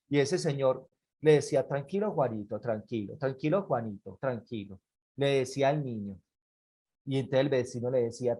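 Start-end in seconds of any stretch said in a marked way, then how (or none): 1.7-4.8 low, soft, self-soothing repetition; calming. Acted. Man under his son's blows, low soothing murmur. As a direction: keep it down and soothe with each phrase.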